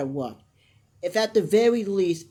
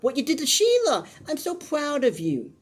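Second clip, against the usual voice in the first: gushing daughter; 8 semitones higher than usual. Retold or imitated. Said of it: "It's like".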